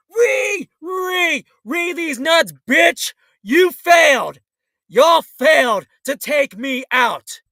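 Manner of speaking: loud jeering shout; taunting his haters with a loud yell; defiant taunt. Real, not acted. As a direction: bark the taunt loud and hard.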